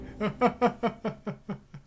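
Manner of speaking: short high falling laugh. Real, not acted. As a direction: burst out laughing at yourself. Laughs at own failure.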